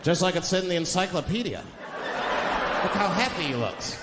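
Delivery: gawk at him like a zoo exhibit; mocking onlookers. Racist onlookers watching him eat.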